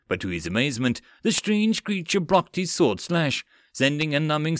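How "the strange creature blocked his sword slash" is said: usual speaking voice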